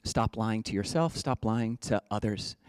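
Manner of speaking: normal talking voice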